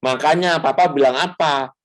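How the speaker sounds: nagging parent. Retold or imitated. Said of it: "orang tua kan biasanya kan gini".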